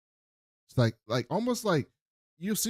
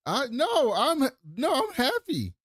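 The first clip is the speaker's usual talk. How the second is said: very high-pitched, happy insistence; delighted protest; 13 semitones higher than usual. Real, not acted. Host reassures offended co-host, delighted protest. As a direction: squeal it in gleeful self-defence.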